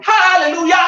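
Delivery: high, loud joyful cry; exultant hallelujah. Real, not acted. Preacher praising God in front of the congregation, high exultant cry. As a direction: throw it up in praise.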